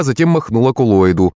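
ordinary delivery, nothing marked

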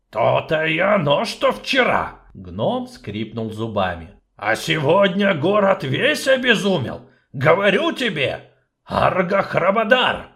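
loud, gruff, swearing; angry dwarf. Acted. Dwarf cursing in anger to the sorceress. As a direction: grind it out through teeth.

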